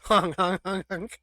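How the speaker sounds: silly clown honk. Retold or imitated. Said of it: "He just goes".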